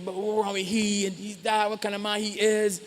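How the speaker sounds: judging train passenger. Retold or imitated. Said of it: "people on the train".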